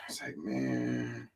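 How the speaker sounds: slow, dragged-out sigh of defeat; exasperated drawl. Real, not acted. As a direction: let the word trail off, worn out. Exasperated at long speech.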